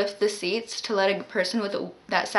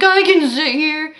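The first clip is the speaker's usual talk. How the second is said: put-on drunk man refusing; 6 semitones higher, 6 dB louder than usual.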